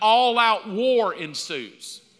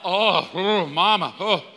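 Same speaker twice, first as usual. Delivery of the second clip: mock swoon. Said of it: "Girl Slider took his shirt off".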